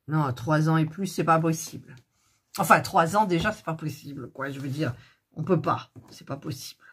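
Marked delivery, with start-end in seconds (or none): 6.1-6.8 breathed out under her breath, almost voiceless; a whispered aside; whispered exasperation. Real, not acted. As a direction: let it slip as a hushed despairing whisper.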